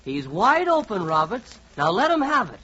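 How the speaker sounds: ordinary talk, nothing marked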